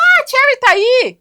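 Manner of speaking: high, loud, slow yelp of surprise; caught-out blurt. Retold or imitated. Ray blurting his girlfriend's name in front of the cameras, a loud high yelp. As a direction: blurt it out like you just got caught.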